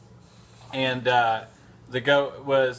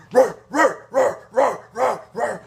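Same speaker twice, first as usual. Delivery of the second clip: loud high barks, much higher; dog bark imitation; 8 semitones higher, 5 dB louder than usual. Man imitating bulldog barking nonstop at goat, loud high barks. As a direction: yap nonstop like a riled-up bulldog. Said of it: "literally like".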